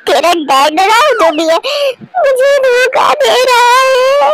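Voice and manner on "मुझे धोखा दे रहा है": mock wounded whine. Wife complains to daughter, hammed.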